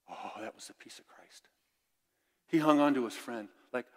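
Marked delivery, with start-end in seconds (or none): 0.1-1.5 hushed, breathy aside; whispered reverence; awed whisper. Real, not acted. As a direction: let the wonder drop to a whisper. Awed aside after the quote.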